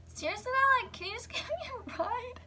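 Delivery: very high, whiny begging with a laugh in it; high-pitched disbelieving plea; incredulous pleading. Retold or imitated. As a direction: imitate yourself pleading in a squeaky high voice. Acting out pleading for ride.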